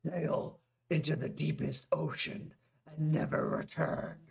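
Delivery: lightly voiced grave warrior; a hint of a stern warrior ordering; Drog voice. A narrator's reading. Drog's voice.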